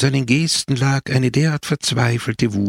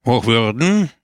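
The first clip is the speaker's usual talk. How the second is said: grim and dictatorial; 3 dB louder than usual. A narrator's reading. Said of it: "sagte Pepone finster und diktatorisch".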